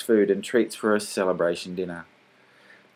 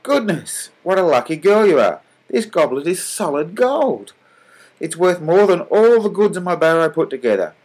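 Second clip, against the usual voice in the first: much higher, louder astonished cry; amazed exclamation; 8 semitones higher, 5 dB louder than usual. A narrator's reading. Honest salesman discovering solid gold, astonished. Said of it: "he exclaimed".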